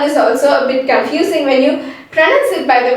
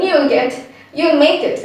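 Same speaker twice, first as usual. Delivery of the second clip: normal talking voice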